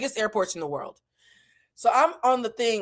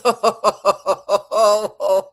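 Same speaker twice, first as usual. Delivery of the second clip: manic villain cackle, loud; Joker laugh; 7 dB louder, much slower than usual. Retold or imitated. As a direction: laugh like the Joker as your spirit leaves. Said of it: "laughing like the Joker".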